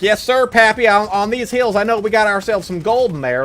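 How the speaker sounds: high, loud hammed-up old miner; answering the ride host, hammed-up; prospector voice. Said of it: "I'm like".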